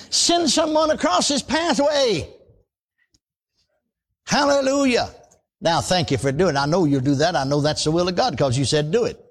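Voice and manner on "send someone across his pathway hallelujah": high, slow, pleading prayer; slow lifted plea ending in hallelujah; fervent prayer. Acted. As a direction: draw the words out to heaven. Praying aloud for a laborer.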